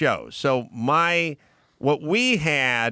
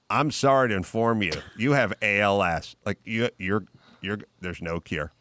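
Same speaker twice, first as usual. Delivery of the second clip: lower, faster mock-grave doctor voice; solemn doctor; 7 semitones lower than usual. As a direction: rattle off the bad news in a lightly hammed bedside tone. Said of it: "And he's like".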